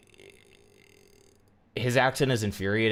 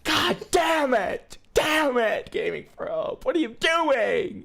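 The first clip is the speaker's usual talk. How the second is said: high, drawn-out screaming dismay; loud wailing outrage at what you see; exasperated shouting; 4 dB louder, much slower than usual. Real, not acted. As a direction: yell in despair, voice way up. Exasperated yelling at the video.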